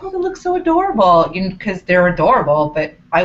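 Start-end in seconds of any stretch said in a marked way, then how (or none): 0.0-1.3 much higher, gushing coo; cooing over kids. Retold or imitated. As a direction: gush over cute little kids. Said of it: "I'm like".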